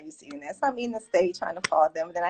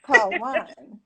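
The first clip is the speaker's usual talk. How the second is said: light bulb moment; 7 semitones higher than usual. Retold or imitated.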